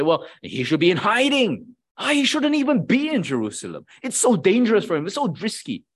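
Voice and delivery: fretting that Paul is in danger; worried doubter. Pretend. Preacher voices a worried onlooker, anxiously. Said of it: "you may look at the life of Paul and say".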